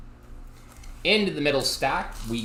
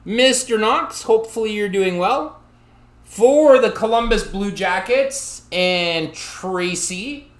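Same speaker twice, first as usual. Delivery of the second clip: loud, slow excited shout-out; 6 semitones higher, 8 dB louder than usual. Real, not acted. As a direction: call out the name with hype.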